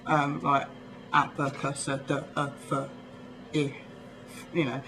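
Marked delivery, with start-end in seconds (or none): 1.1-3.7 "appa, cussa, duh, uh, fuh, eh" as short letter sounds; phonetic letter sounds. Shown as a demonstration. English speaker demonstrating lowercase phonetics, short sounds "appa, cussa, duh, uh, fuh, eh". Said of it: "pronounce it as a lowercase phonetic".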